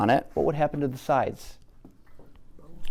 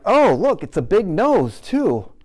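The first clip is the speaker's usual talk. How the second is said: high, louder eager list of big features; excited gushing; 8 semitones higher, 3 dB louder than usual. Retold or imitated. Teacher mimicking an overeager artist, excited and high. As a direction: gush over every feature like a thrilled beginner. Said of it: "and then I'm like".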